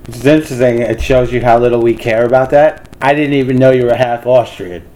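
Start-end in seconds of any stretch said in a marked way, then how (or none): none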